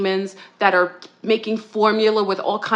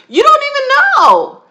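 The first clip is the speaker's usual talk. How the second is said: much higher, louder outburst; ranting shout at the men; exasperated shout; 14 semitones higher, 7 dB louder than usual. Real, not acted. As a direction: yell it in disbelief. Rant at men, peak outburst.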